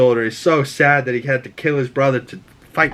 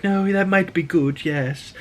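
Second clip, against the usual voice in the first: tired queen; 6 semitones higher than usual. Retold or imitated. Rhaenyra answers sleepily.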